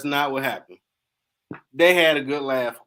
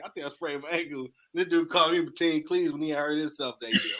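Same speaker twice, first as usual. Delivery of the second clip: usual speaking voice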